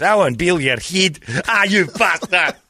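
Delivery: high, thick mock-Scots gabble; garbled Scottish accent. Mimicking two Scots talking together, thick garbled Scottish accent. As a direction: imitate near-unintelligible Scottish banter. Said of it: "I can do the accent".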